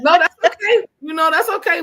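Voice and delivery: high, loud laugh with a sob in it; laughing, near tears. Real, not acted. Guest answers the host, laughing and tearful. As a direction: laugh through happy tears.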